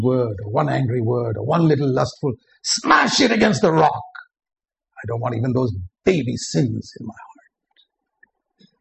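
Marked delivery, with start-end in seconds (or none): 2.6-4.0 fierce urging. Real, not acted. Preacher urges destroying small sins. 5.0-8.7 whisper. Real, not acted. Preacher's quiet personal resolve.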